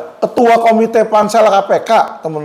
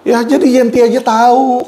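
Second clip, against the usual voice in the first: ordinary talk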